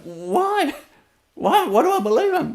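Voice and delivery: high, incredulous self-questioning. Real, not acted. Wondering aloud why he believed the insult, high and incredulous. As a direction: ask yourself in disbelief. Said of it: "asks himself why".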